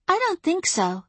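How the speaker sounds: put-on very high, light little girl voice; high childlike warning. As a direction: sweetly stop her cold. Child fae stops witch.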